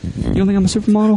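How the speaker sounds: high, fast pleading question; mock needy. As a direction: fish for a compliment. Repeated fishing.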